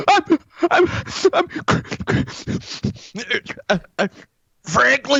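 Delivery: mock crying. Trump impression breaking into tears.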